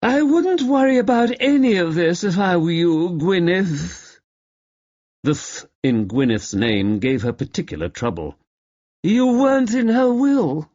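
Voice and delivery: high, slurred tipsy taunt; words sliding out drunk; drunken slur. Acted. Drunk actress taunting Gwyneth across the table, slurred. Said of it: "slurring her words".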